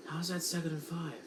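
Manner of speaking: quick, soft, disbelieving aside; can't believe the scorecard; a puzzled half-mutter to himself; incredulous question. Real, not acted.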